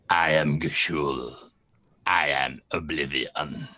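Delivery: deep, hushed otherworldly villain; voice of oblivion. Acted. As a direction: speak as a dark god claiming a soul. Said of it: "is all you hear".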